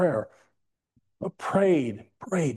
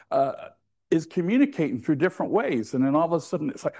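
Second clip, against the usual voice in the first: ordinary delivery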